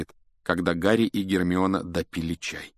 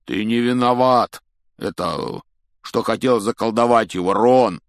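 big raised voice with a hesitation; shouting; 3 semitones higher, 7 dB louder than usual. A narrator's reading. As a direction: call it out over a noise. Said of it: "крикнул Хагрид".

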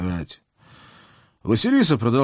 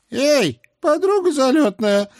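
put-on high, loud outraged old hag; indignant old witch; 5 dB louder than usual. A narrator's reading. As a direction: shout at her in outrage. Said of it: "праведно возмутилась стройная яга".

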